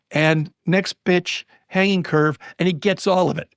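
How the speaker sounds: ordinary delivery, nothing marked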